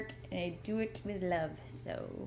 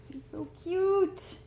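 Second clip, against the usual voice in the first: cooing; 10 semitones higher, 5 dB louder, much slower than usual. Real, not acted. Cooing over her cat.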